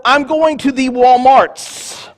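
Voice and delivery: slow, drawn-out mocking of "the Walmarts"; mock Walmarts speaker. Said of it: "That one really grinds me".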